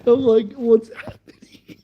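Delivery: high, breaking up with laughter; laughing. Real, not acted. Storyteller cracking up at his own anecdote, laughing. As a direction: say it through real laughter.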